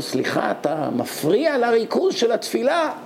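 indignant. Retold or imitated.

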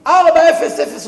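ארבע אפס אפס shouted high and loud; loud exclaimed emphasis. Shown as a demonstration. Preacher stressing the rabbi's exact punctuality, ארבע אפס אפס shouted high. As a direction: belt out ארבע אפס אפס in high-pitched astonishment. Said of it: "איך אומרים?".

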